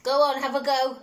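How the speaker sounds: ordinary delivery, nothing marked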